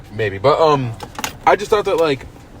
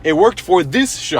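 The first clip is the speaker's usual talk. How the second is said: "this" pitched up in insistence; stress on THIS; 5 semitones higher than usual. Real, not acted. Insisting to a doubtful co-host, stress on "this". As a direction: hit "this" higher to limit the praise. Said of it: "I wanna emphasize that".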